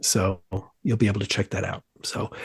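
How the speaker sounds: ordinary talk, nothing marked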